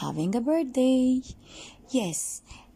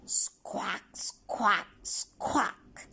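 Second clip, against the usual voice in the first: mild imitated parrot squawk; much slower than usual.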